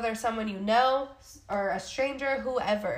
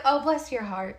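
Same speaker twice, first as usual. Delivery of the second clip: mock-sweet sarcastic reply, slightly raised; 3 semitones higher than usual. Answering a negative commenter, sarcastic. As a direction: lay on light, sarcastic sympathy. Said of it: "it's always sarcastic".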